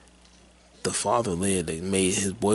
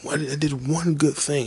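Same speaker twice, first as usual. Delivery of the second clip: high-pitched, mock-desperate plea; raised, pleading voice of an imitated breakdown; retold tearful outburst; 7 semitones higher than usual. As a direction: plead it, imitating someone crying and yelling. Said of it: "he's crying and screaming like".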